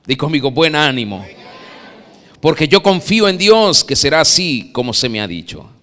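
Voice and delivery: usual speaking voice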